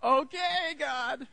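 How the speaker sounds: laughing little squeal of a giver; giggly mock surrender. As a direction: play a giddy giver giving in to God. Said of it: "Give until you're like".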